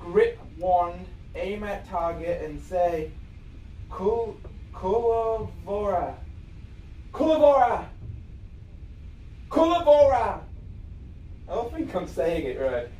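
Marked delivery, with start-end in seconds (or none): 3.9-6.1 slow, halting first try at "Kulavora"; sounding it out. Real, not acted. Sounding out a new spell word. 7.1-10.4 firm, projected "Kulavora", like an incantation; casting a spell. Pretend.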